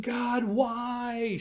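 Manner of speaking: a high, pleading wail at God, acted out briefly; mock anguished cry. Preacher mimics a mother crying to God over her child, high mock wail. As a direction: cry out to heaven in mock despair. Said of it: "She doesn't start screaming".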